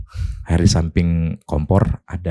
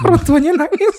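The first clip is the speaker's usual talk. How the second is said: laughing; 4 dB louder than usual. Real, not acted. Tertawa saat menceritakan kenangan pahit.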